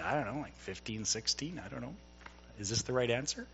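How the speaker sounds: nervous, hushed and hesitant; timid quiet answer. Retold or imitated. Traveler nervously answering a stern border guard, quiet and hesitant. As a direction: mumble it like you're unsure of the right answer.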